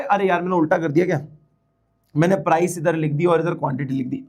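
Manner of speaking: ordinary delivery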